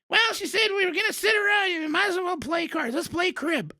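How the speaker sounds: put-on a feisty old granny pushing a game of cards; grandma's voice. Retold grandma suggesting cards.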